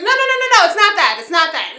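high, loud mock yell of objection; mom yelling. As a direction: shout a correction like mom from the background. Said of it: "yelling in the background".

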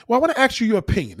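hammed-up high mock of her excuse; mocking high woman. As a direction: rattle it off high and prissy. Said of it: "This is what they say".

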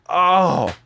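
imitated heartfelt relief; acted relief. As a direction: let out a big relieved "oh". Friends relieved to learn the cause.

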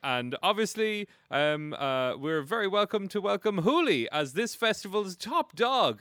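high, loud, slow ceremonial welcome; mayor announcing. Acted. Game master as the mayor welcoming the top dog, announcing. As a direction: announce the guest of honour grandly. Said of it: "it ends with him going".

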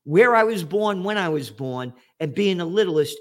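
ordinary talk

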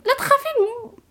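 high mocking parrot of لا تخافين; mock advice voice. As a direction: mimic them in a high silly voice. (تقليد ساخر بصوت عالي)

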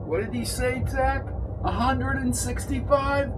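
a lightly voiced confused kid; high young man voice. A narrator's reading. Zach voiced in story.

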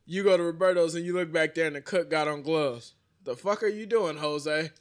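usual speaking voice

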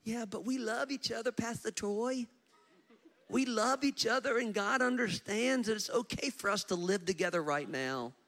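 high-pitched imitation of excuse-makers; mimicked earnest protest; mock pleading. Mimics excuse-making unmarried couple.

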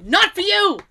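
loud, high mock-mom taunt; gleeful refusal. Mother refusing her fasting husband coffee, loud taunting. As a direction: imitate mom shouting him down.

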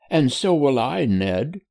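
a big brother promising eagerly; older brother. Acted. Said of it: "said George, his elder brother".

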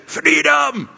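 high, loud, drawn-out battle cry; Mel Gibson's Braveheart shout. Retold or imitated. Preacher mimicking a Scottish film for schoolboys, Braveheart shout. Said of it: "Can you shout out".

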